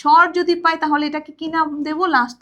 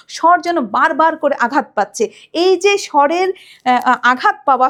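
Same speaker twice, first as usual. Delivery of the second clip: normal talking voice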